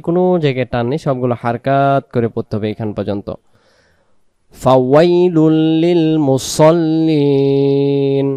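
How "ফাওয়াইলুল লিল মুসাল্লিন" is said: slow melodic Quran recitation; tajweed recitation. Acted. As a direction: chant each word slowly. Recites the verse slowly.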